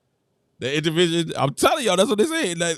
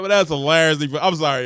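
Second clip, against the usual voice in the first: ordinary delivery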